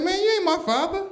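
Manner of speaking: high mocking kid voice. Comic retelling, son sassing his father in a high squeak. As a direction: play the sassy boy way up high. Kid talking back to dad.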